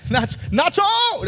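high, slow, hammed-up Nacho cry; playful mock Nacho shout; Nacho impression. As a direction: stretch it out like the movie. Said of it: "pastor echoes Nacho Libre".

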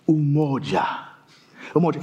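"umoja" with a rounded pure O, low; African pronunciation. Shown as a demonstration. Preacher teaching congregation an African word, native pronunciation of "umoja". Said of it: "can I teach you an African word".

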